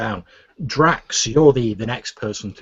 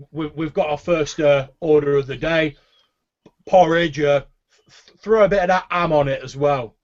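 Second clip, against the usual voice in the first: raised, higher barkeep voice; shouting an order back; 6 semitones higher, 4 dB louder than usual. Acted. Orc barkeep passing first breakfast order to the back, calling out. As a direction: call the order through to the kitchen. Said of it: "he shouts back".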